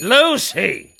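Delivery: loud, high call for the maid. Acted. Master calling for the maid, loud and high. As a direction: shout for her from downstairs.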